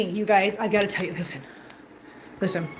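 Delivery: ordinary talk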